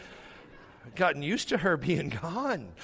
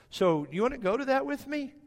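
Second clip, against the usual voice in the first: higher, lilting question as the wife; hopeful invitation; 11 semitones higher than usual. Retold or imitated. Wife asks husband to the parent-teacher meeting, high coaxing question. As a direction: ask it high, coaxing him along.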